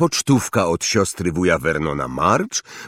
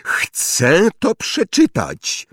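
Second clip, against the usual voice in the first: high, slow, insistent demand; loud announcement; 10 semitones higher, much slower than usual. A narrator's reading. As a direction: demand it like a spoiled boy. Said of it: "oznajmił głośno". (głośne, przeciągłe żądanie)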